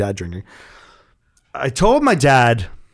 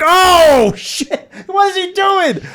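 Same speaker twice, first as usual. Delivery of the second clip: very high, drawn-out mock yelp of shock; shocked friends' outcry; 5 dB louder, much slower than usual. Friends watching dad pee by the car, shocked outcry. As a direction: imitate a carful of scandalized teenagers.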